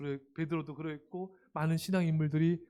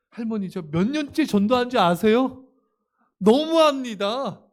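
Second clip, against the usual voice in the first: much louder, higher, slowing at the end; mock frustrated outburst; 6 semitones higher, 12 dB louder than usual. Evangelist losing patience with refusing grandmother, loud. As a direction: complain bitterly, drawn out.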